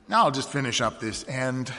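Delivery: usual speaking voice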